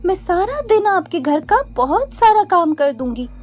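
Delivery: put-on high, humble pleading girl voice. Witch posing as Rekha begging Mohan for work, high and humble.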